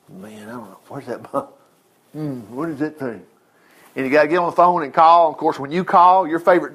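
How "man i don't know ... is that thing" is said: worried self-mutter. Retold or imitated. Worried self-talk at the mirror.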